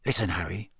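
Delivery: low and hushed, leaning in close. A narrator's reading. Bagman confiding low to Harry, hushed. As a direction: drop the voice to a near hush. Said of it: "still in a very low voice".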